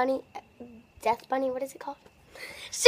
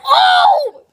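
pained shout; 14 semitones higher, 9 dB louder than usual. Real, not acted.

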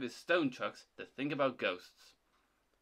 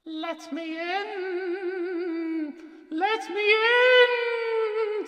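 high, drawn-out ghostly wail, growing louder; spooky voice; 8 dB louder, much slower than usual. A narrator's reading. Percy haunts Thomas at the shed doors, spooky. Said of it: "said Percy in a spooky voice".

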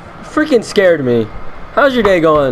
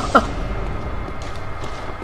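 soft wheezing laughter; quiet, voiceless laughing fit; relieved laugh, mostly air; breathy laugh; 11 dB quieter than usual. Real, not acted. Amused at surviving.

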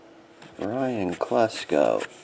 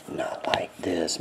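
whisper; 4 semitones lower, 7 dB quieter than usual. Real, not acted. Joking about a dance.

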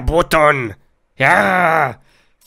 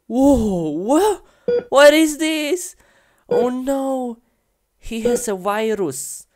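higher startled gasp into a question; shocked; 9 semitones higher than usual. Real, not acted. Player opening the cow finds something, shocked and higher. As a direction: recoil at what you find.

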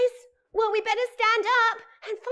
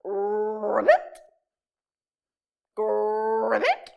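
low croaking frog; 10 semitones lower, much slower than usual. Acted. A frog croaks in the dark forest.

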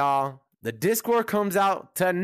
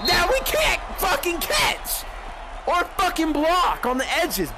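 frustrated yell; 8 semitones higher than usual. Real, not acted. Raging at teammates in Madden.

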